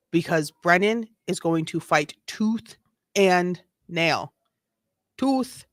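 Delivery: usual speaking voice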